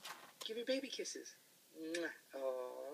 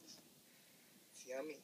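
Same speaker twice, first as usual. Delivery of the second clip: slow, soft whisper; whispered tempting; 6 semitones lower, 11 dB quieter, much slower than usual. Real, not acted. Tempting toddler with snack.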